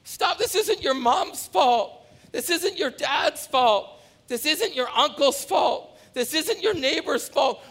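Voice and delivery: high, loud, slow shout of stop, with a laugh at the end; mock outburst at excuse-making. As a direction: cut them off hard, then hammer each excuse. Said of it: "I'm like".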